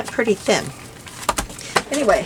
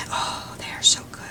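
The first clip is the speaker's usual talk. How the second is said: whispered; 5 dB quieter than usual. Real, not acted. Savouring the donuts.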